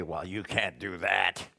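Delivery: a hint of a scornful Satan; Satan's taunting voice. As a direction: sneer it like the devil doubting you. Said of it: "Satan's going to say".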